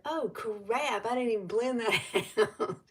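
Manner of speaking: replaying the moment she caught the unblended blush; retold dismay. Sitting in the car before work, mildly dismayed and laughing. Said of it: "and I looked, and I said".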